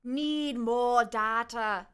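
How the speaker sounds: slow, deliberate robotic line; lightly voiced machine announcer; robot game voice. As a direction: intone it like a game unit. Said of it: "I can't do the low voice like the".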